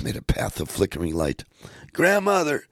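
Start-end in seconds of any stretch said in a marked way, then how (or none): 1.9-2.7 very high, louder call; crying out. Acted. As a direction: call after her as the boat drifts away. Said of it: "Kasumi cried".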